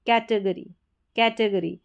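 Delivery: normal talking voice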